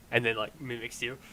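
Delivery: normal talking voice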